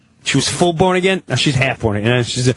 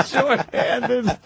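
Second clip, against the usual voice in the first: laughing echo; 9 semitones higher, 4 dB quieter than usual. Real, not acted. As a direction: own voice, laughing as he repeats Shorthanded. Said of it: "echoing the cop's word".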